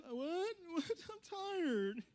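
high, drowsy whine; Peter. Acted. Peter answering Jesus after dozing off, drawn-out tired whine. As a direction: stretch it out like someone just woken. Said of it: "Peter like this".

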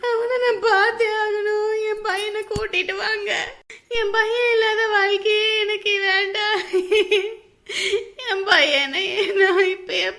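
wailing sob. Acted. Mother begs the family to bring her son back, sobbing. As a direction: wail for your missing son.

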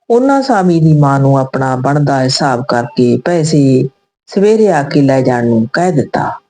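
usual speaking voice

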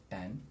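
"tan" said like the colour tan; "tan" with a short a, one syllable; dictionary pronunciation. Shown as a demonstration. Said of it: "abbreviated as T A N, pronounced as".

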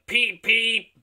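high, loud whistle peep. Acted. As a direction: toot like a whistle. Whistle imitation.

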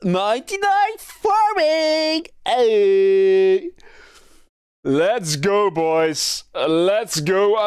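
hyped bro cheer, high; frat bro. Real, not acted. Hyped after maxing skills.

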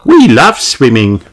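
put-on high-pitched, cheerful child character; Rebecca. A narrator's reading. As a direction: pipe up high like a happy child. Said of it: "says Rebecca".

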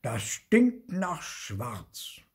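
slow, weighty pronouncement; slow verdict. A narrator's reading. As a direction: stretch each word of the finding. Ghost gives smell verdict.

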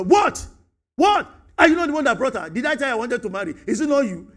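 loud, high, outraged comeback; indignant retort. Retold or imitated. Adam talking back to God, loud and outraged. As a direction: fire back in disbelief. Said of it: "I don't like the way you spoke".

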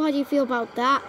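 ordinary talk

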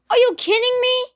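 high furious yell; raging gamer. Real, not acted. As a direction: scream it at the screen.

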